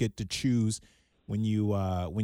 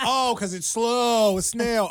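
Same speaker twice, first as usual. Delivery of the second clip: slow realization; 8 semitones higher, 5 dB louder, slower than usual. Retold or imitated. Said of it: "I was like".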